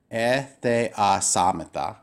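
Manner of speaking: "E, the, a, samata" in separate pieces; syllable by syllable. Shown as a demonstration. Teacher divides the syllables for students, spaced-out pronunciation. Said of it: "I would divide the syllables this way".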